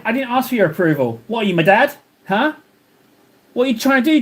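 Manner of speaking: ordinary delivery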